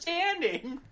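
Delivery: high, disbelieving "Misunderstanding?"; can't believe that excuse; incredulous. Real, not acted. Cohost scoffs at Walmart's statement in disbelief.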